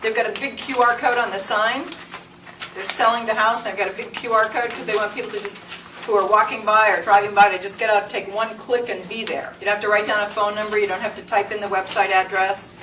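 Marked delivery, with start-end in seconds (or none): none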